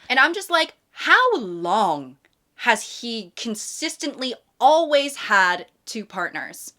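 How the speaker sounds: ordinary talk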